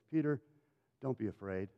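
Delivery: soft, low, smiling comfort; calm, hushed reassurance; gentle reassurance. Acted. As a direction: soothe quietly and tenderly. Jesus reassures Peter, smiling.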